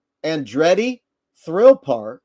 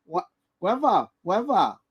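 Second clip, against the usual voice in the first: put-on toddler with a pacifier; 4 semitones higher than usual. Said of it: "with the binky in his mouth".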